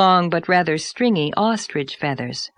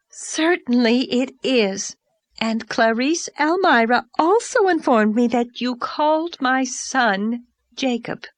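pompous society lady, pitched higher; Mrs Donnell; 3 semitones higher than usual. Acted. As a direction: announce yourself like a grand overdressed lady. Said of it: "announced this vision".

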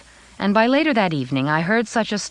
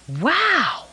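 amazed wow; 10 semitones higher than usual. A narrator's reading. Sees huge diamond ring.

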